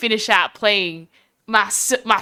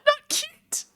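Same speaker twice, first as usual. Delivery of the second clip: very high, soft "not cute"; high tiny disapproving voice; squeaky complaint; 4 dB quieter than usual. Real, not acted. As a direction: squeak it out in protest. Reacting to ad.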